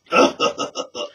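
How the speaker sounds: put-on a breathy little demon-child laugh; eerie baby giggle. Ghost baby laughs after revealing teeth.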